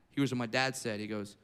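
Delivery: ordinary talk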